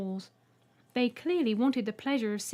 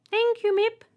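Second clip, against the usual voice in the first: very high, loud piercing voice; Trembler; 13 semitones higher, 8 dB louder than usual. A narrator's reading. Alien greeting the human, piercing high voice. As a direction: squeak it out politely. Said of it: "the one in the centre said".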